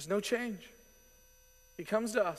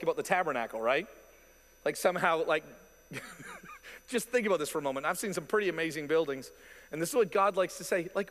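ordinary talk

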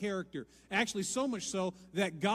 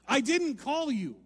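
slow, louder and higher; emphatic repeat; 9 semitones higher, 10 dB louder, slower than usual. Acted. Preacher repeating Eli's answer for emphasis. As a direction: drive the line home.

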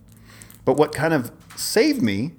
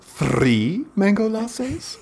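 higher, very slow, drawn-out suggestive question; suggestive theatrical owner; 9 semitones higher, much slower than usual. Retold or imitated. Restaurant owner confirming the drinks order, slow suggestive drawl. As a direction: stretch it out like a showy host teasing an order. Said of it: "it was always like this suggestive something".

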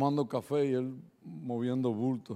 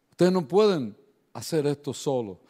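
usual speaking voice

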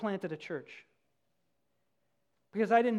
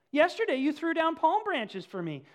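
indignant; 9 semitones higher, 7 dB louder than usual. A narrator's reading. Donkey pleading in market.

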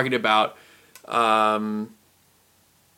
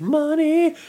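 high squeaky repeat. Pretend. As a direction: squeak the word like a joke. Echoing co-host, squeaky joke.

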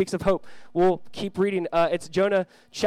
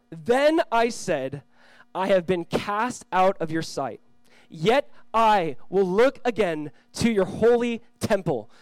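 slow, drawn-out, solemn verse; slow scripture reading; 3 semitones higher, 5 dB louder, much slower than usual. Acted. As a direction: linger on every word of the verse.

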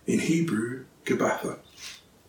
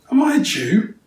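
a pointed, higher retort; sharp retort; 9 semitones higher, 5 dB louder than usual. A narrator's reading. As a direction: fire the question back at him. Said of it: "Pilate retorted".

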